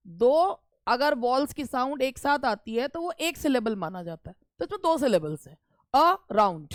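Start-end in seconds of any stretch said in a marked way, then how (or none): 5.9-6.7 "around" in two beats, अ then stressed round; stress on second syllable. Shown as a demonstration. Teacher showing two syllables, stress on round in "around". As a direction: keep अ short and put the stress on round in "around". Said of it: "round में उठ रही है".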